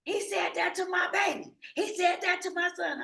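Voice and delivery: high, loud upset mother voice; imitating frantic mom. Wife on the phone, upset about her son, in a high loud voice. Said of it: "All she kept saying on the phone is".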